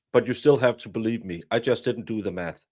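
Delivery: ordinary delivery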